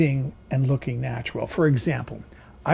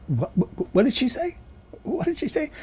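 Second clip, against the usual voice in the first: confused viewer; 9 semitones higher than usual. Retold or imitated. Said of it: "She talks fast".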